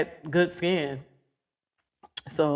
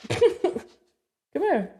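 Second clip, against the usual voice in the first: high, laughing coax; high-pitched laughing call to the dog; 10 semitones higher than usual. Real, not acted. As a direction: giggle while calling the dog. Coaxing the hiding dog.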